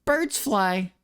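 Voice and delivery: put-on high, fast cocky Boston kid voice; brash bragging Scout; high taunting Scout mimic; Scout impression. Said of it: "Mimic Scout from T F two".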